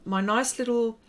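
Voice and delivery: ordinary talk, nothing marked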